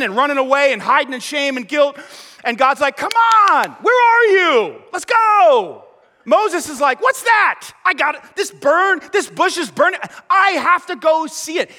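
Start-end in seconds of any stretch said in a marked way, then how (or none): 3.0-5.8 calling out high and loud; a loud, high call to come out of hiding; God calling out. Retold or imitated. 7.0-11.7 higher, eager exclamations; thrilled to see it; excited Moses. Retold or imitated.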